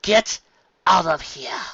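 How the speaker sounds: stern order. Acted. Carrot Top orders Flim out, stern. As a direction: order him out. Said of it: "ordered Carrot Top".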